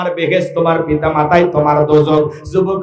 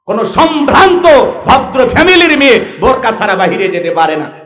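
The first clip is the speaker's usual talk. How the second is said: higher-pitched, emphatic sermon delivery; full-force preaching; 8 semitones higher, 4 dB louder than usual. Acted. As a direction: preach it out to the crowd, punching key words. (জোরালো ওয়াজের ভঙ্গি)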